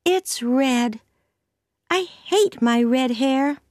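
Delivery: put-on high child voice with mild dismay; high-pitched, unhappy child; sad little girl. A narrator's reading. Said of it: "said the little girl sadly".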